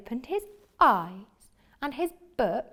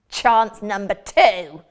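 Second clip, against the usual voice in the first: slow, loud wizard line; a hint of a nasty wizard; 10 dB louder, slower than usual. Wizard speaking in story.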